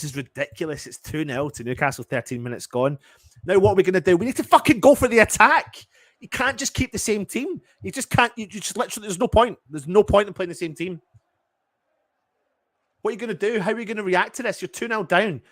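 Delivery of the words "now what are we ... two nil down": high, loud exasperated yelling; angry rant. Real, not acted. Fan yelling at his team's manager, furious and high. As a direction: shout your frustration at the manager.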